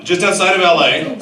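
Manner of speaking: usual speaking voice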